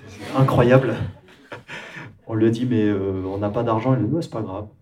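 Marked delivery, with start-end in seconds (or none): 0.1-0.9 laughing amazement. Real, not acted. Amazed at [name]'s yes.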